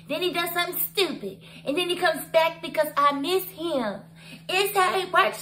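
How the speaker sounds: high, put-on Karen voice lecturing; Karen impression. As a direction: play her as a know-it-all, laughing through it. Voicing Karen explaining herself.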